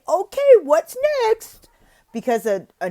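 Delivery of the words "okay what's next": loud, much higher, slow announcement; loud high transition line the teacher warns against; wrong way. Shown as a demonstration. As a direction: show the wrong way to move on. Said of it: "don't say".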